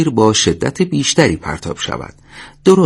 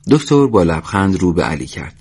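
ordinary delivery

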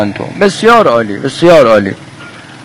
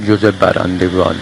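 lower, deep joking voice; 8 semitones lower, 4 dB quieter than usual. Acted. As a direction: drop into a mock deep voice. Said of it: "باید با صدای بم".

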